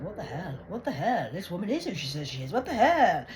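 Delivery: imitating a fuming boss, building to a high loud shout. Bank boss fuming at the news, mock angry shout. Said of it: "He's like".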